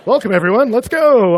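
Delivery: high, loud mock welcome; sarcastic rallying call. Titanic captain welcoming everyone aboard, mock-cheerful shout. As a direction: holler it like a doomed captain.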